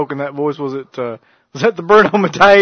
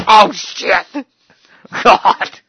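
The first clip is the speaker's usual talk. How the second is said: loud, higher mock exclamation; imagined dismay; 5 semitones higher, 5 dB louder, much slower than usual. Imagining a real Italian seeing Fazoli's, loud mock dismay. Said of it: "They'd be like".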